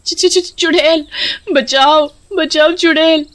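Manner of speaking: high-pitched stuttering cry for help; terrified. Acted. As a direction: stammer in fright, then call for help. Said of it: "उसके होश उड़ जाते हैं". (डर से हकलाती चीख)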